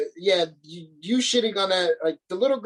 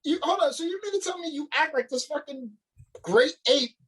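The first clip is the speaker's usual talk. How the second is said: much higher, disbelieving; you can't be serious; challenging a friend's claim in disbelief; incredulous; 6 semitones higher than usual. Real, not acted. Incredulous outburst.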